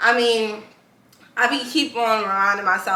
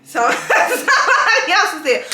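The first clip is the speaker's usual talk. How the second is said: high and a bit louder, laughing through it; 11 semitones higher, 5 dB louder than usual. Real, not acted. Caught mixing up the Marys, laughing it off. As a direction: laugh off the mistake. Laughs at her own mix-up.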